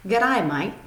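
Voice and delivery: punchy Australian-accented greeting. Shown as a demonstration. Teacher modelling hello to a friend, Australian accent. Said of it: "in Australian English".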